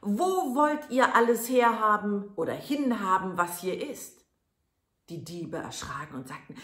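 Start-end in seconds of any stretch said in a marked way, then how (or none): 0.0-4.2 loud, high yell; full-voiced high shout; shout with all his might. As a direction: bellow through the whole house. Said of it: "schrie aber gleich aus Leibeskräften".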